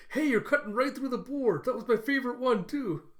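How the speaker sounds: high, loud mock outrage; indignant protest. [name] protests over her ruined cutting board, indignant. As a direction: act out an indignant complaint.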